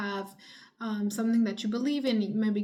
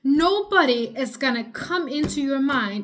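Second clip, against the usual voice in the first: slow, loud and higher, hammering each word; angry; 3 semitones higher, 6 dB louder, slower than usual. Real, not acted. Venting at viewers stuck in daydreams, slow angry shout. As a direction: let the frustration boil over. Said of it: "Now I'm getting angry".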